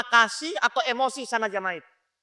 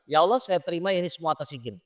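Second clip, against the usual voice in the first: quick murmured prayer; 4 semitones lower, 7 dB quieter, faster than usual. Retold or imitated. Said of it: "langkah yang pertama saya terima".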